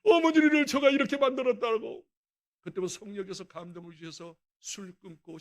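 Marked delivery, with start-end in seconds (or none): 0.0-2.1 loud, higher anguished begging; tearful plea. Retold or imitated. Son embracing his mother, loud higher tearful plea for forgiveness. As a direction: beg forgiveness through remorse.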